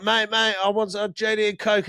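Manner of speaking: calling to the barman like a tipsy mate; drunk me ordering. Retold or imitated. Retelling her own drunk order to the barman, high mock drunk. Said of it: "And I'm like".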